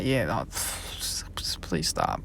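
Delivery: whispered, slow and breathless, cringing; embarrassed whisper. Real, not acted. To himself about his classmate, a cringing whisper. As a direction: hush it out under your breath, mortified.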